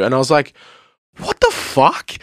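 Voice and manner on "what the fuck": retold disbelief. Patient reacting to a mono diagnosis, retold incredulous disbelief. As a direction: replay your shock with the voice shooting up.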